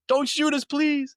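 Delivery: very high mock pleading cry; imitated screaming. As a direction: mimic panicked players begging in a high voice. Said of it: "they're just, like, screaming".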